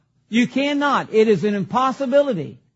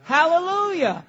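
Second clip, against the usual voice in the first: higher, ringing praise cry; hallelujah cheer; 4 semitones higher than usual. Shown as a demonstration. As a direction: model it for the congregation to repeat. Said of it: "Say that".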